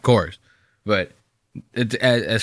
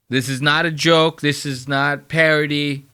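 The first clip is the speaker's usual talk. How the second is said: ordinary delivery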